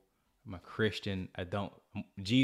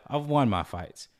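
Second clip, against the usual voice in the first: normal talking voice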